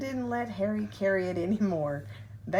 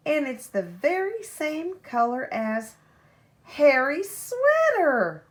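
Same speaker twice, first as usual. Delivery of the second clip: louder and higher, the last words stretched slow; shouted together; 7 semitones higher, 9 dB louder, slower than usual. A narrator's reading. Children recognising Harry's sweater, joyful slow shout. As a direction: shout the punchline out, drawn out. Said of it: "they shouted together".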